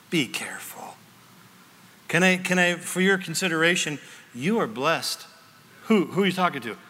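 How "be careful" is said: soft, lower, unvoiced caution; whispered warning. Real, not acted. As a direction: lean in and warn them in a whisper. Pastor's quiet warning.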